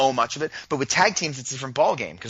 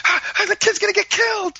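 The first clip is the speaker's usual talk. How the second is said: put-on much higher, panicked little boy; excited kid voice; 5 dB louder than usual. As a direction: squeal it like a thrilled six-year-old. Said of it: "he's like".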